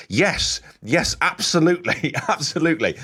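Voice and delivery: high, gleeful yes with a laugh; gushing like a happy fan; delighted fan. Retold or imitated. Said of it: "if I'm a Tottenham fan, I'm thinking".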